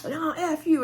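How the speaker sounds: high, sharp imitated F you; hammed-up angry comeback; mock snapped curse. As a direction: toss it back at him defiantly. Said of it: "And she was like".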